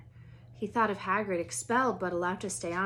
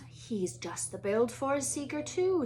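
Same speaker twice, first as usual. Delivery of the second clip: hushed, breathy assessment; sizing him up under his breath; whispered aside while circling the boy; Wood whispering; 6 semitones higher than usual. Acted.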